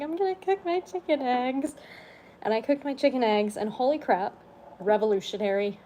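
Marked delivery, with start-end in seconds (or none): 0.0-1.8 imitating crying. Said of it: "like, crying".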